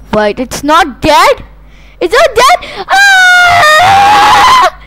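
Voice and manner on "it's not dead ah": high, loud crying shriek; high shrieking panic; terrified scream. Pretend. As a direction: scream in disbelief and fright. Zombie still alive.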